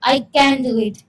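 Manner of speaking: ordinary delivery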